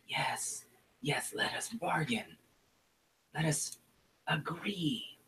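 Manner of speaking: a sly shaman sharing a secret grudge; goblin shaman whisper. Acted. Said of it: "And she says".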